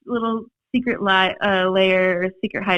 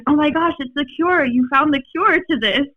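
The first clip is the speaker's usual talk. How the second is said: higher, eager discovery voice; a bit thrilled, pitched up; excited researchers; 7 semitones higher than usual. Retold or imitated. Lab researchers realizing the spill made the cure, excited. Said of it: "they're like".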